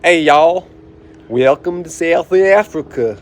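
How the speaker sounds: put-on slow, drawn-out South African accent with a Southern twang; mock South African accent, drawn out; Southern-tinged South African accent. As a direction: greet like a South African tour guide, slow. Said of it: "My Siri is set to South African".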